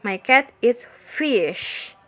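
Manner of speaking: slow, with "fish" drawn out and emphasized; stress on fish. Shown as a demonstration. Teacher modelling an emphasized noun, stressed "fish". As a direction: take it slowly and land on "fish". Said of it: "hear how the focus words are emphasized".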